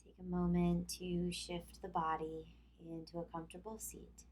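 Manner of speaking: calm yoga instruction with long pauses; guided relaxation. Acted. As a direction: lead them through tensing and releasing, leaving room to breathe. Leads tense-and-release relaxation exercise.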